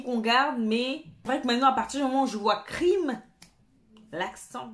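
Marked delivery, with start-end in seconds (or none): 2.6-3.2 English accent. Shown as a demonstration. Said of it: "vous avez vu l'accent?".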